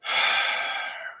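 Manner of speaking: long, soft exhale; imitated resigned sigh. Radio DJ giving in to the caller, with a long sigh. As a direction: give a heavy sigh of giving in. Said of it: "And I hear him say".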